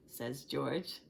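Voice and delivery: usual speaking voice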